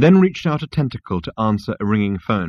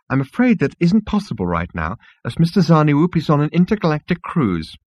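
higher, officious clerk voice; prim insect receptionist, petulant; 6 semitones higher than usual. Acted. Insect receptionist fobs off Zaphod, petulant. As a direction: turn the visitor away primly.

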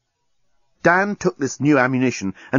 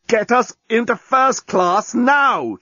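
higher, slow strained threat; choking threat; 10 semitones higher, slower than usual. A narrator's reading. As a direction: demand through choked breath. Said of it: "choked the journalist".

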